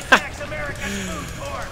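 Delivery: breathy high chuckle; amused giggle; laughing. Real, not acted. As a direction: crack up at your own silly accent. Laughs at his own accent.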